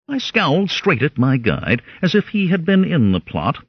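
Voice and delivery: plain reading voice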